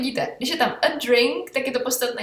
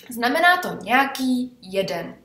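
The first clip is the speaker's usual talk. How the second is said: ordinary delivery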